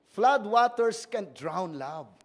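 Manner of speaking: weighty pulpit reading; slow emphatic scripture reading. Shown as a demonstration. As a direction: proclaim it, lingering.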